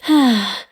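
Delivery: short falling sigh. Acted. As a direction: let out a wistful sigh. Sighs before voicing doubts.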